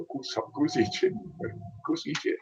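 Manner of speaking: "kus ikke" with ikke as "itchy"; Karmøy dialect. Shown as a demonstration. Showing grandparents' "kus ikke", Karmøy dialect pronunciation. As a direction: try out "kus ikke" with a soft ch for the kk. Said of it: "in Karmøy dialect, my, my grandparents' dialect".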